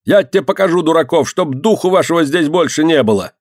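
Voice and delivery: high-pitched angry scolding; cursing. A narrator's reading. Yardkeeper pushing organ-grinder out, scolding. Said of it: "ругался дворник".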